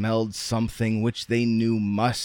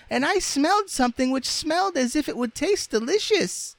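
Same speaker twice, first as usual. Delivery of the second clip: very high, squeaky little doll. A narrator's reading. Doll telling the others of a tasty smell, squeaky doll voice. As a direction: pipe it up in a tiny falsetto.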